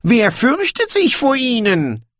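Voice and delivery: high, incredulous question; astonished dwarf king's retort; surprised. A narrator's reading. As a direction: ask it high, as if amazed. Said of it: "erwiderte Brokando überrascht".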